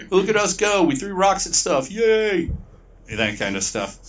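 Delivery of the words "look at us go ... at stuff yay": higher, gleeful mob cheer; mock cheering mob. Retelling a mob cheering over thrown rocks. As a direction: play the dumb rioting mob celebrating. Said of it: "as a mob will be".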